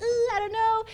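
put-on high, hesitant little-kid voice; nervous kid. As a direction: imitate a shy child balking. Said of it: "kids are kind of nervous at first".

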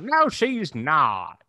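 drawn-out mock twang; twangy, stretched-out mom impression; mom's twangy accent. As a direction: imitate a mom with a slow, exaggerated twang. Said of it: "Your mom sounds like".

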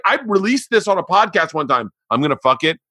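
ordinary delivery

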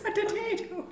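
put-on high, light Irish accent. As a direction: say potato in a mock Irish accent. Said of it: "It's just doing an Irish accent".

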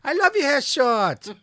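high, loud, drawn-out squeal; gushing fan shout. Acted. Fan gushing over an actor's haircut, high loud squeal. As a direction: shriek it like an overexcited fan.

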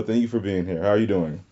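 ordinary talk

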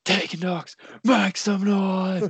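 high, slow hype call; wrestler shout. Retold or imitated. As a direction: call it out like a ring entrance. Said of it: "she just screams out".

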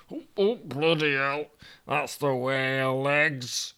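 a put-out northern man, lost in London; Toby grumbling in Yorkshire voice; grumpy Yorkshireman Toby. Acted.

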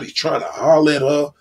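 usual speaking voice

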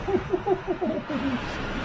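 very high, soft titter; scatty lady's tittering laugh; falsetto giggle. Acted. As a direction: trill a fluttery little laugh. Flo titters in character.

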